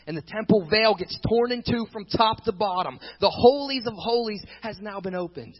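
loud, high proclamation; preacher crying out the climax; fiery preaching. Acted. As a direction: proclaim it like a revelation. Climax of the sermon.

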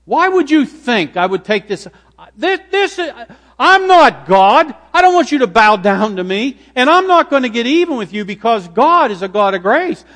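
loud, high-pitched exclaiming, starting and restarting; impassioned protest. Acted. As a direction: protest at full voice, astonished they would think it. Acts Joseph protesting to his brothers.

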